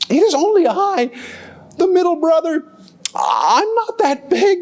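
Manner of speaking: high, timid goat voice; middle billy goat. Acted. Middle goat pleading with the troll, high and timid. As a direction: play small and harmless.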